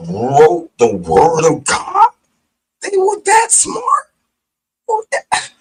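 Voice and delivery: high, loud, slow disbelief; incredulous outcry. Real, not acted. Ranting to viewers about the Bible, incredulous outcry. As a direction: stretch it out, can't believe it.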